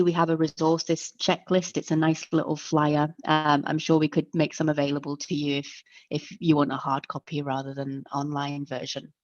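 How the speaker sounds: ordinary talk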